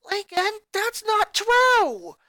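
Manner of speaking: high, loud pleading cry, slow at the end; a brother pleading with Lincoln; cried out. Acted. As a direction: protest desperately. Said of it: "Lenny cried".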